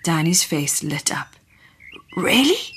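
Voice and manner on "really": hopeful. Acted. Danny lights up, hopeful.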